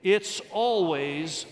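very slow, loud, drawn-out words; leading aloud together. Shown as a demonstration. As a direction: lead the congregation, stretching each word. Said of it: "Let's say it".

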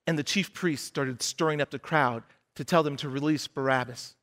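normal talking voice

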